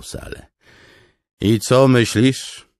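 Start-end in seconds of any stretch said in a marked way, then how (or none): 1.4-2.7 loud, high, slow showman question; proud host. Acted. Noro showing off the mess hall to Kaladin, proud loud. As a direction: sweep the room with pride.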